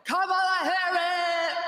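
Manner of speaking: high, drawn-out hammed-up yell; mock Oprah yell. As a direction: belt the name like a hammed-up host. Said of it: "You get Oprah yelling at you".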